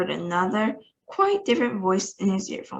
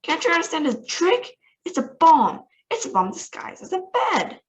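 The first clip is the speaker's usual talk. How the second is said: higher urgent alarm; 9 semitones higher than usual. Acted. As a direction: sound the alarm. President panicking about bomb.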